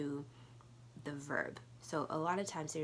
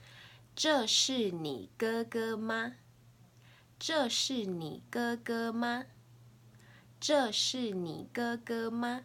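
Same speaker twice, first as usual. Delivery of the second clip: slow, higher-pitched Mandarin question for learners; deliberate teacher's demonstration, pitched up; slow question model; 6 semitones higher, 4 dB louder, slower than usual. Shown as a demonstration. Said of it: "I'll say it three times".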